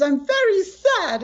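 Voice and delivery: put-on high, slow whimpering little girl; imitating sad granddaughter. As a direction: mimic a six-year-old's tearful whine, with a laugh. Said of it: "And she says".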